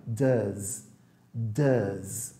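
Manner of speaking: does with a soft buzzing z at the end. Shown as a demonstration. Teacher showing a soft z in does. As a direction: let the z in does buzz softly. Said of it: "um Zzinho suave".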